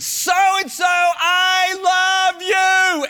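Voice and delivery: high, loud, drawn-out shout of love; screamed name. Retold or imitated. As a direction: cry it out across an empty playground. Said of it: "I screamed the guy's name out".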